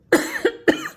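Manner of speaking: dry breathy cough from dust. Real, not acted. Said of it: "sorry, I got dust in my throat".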